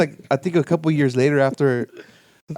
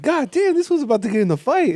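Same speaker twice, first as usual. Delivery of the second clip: mock alarm; 10 semitones higher than usual. Retold alarm at the beggar.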